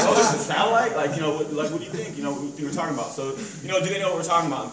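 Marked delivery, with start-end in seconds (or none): 0.0-1.0 high, loud mock double take; hammed-up startled customer; mock surprise. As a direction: play the customer just catching on. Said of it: "you're like".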